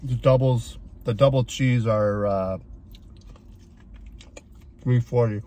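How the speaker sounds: chewing between words; biting burger while talking; mouth full. Real, not acted. Seen 3: chews throughout and takes a big bite mid-sentence.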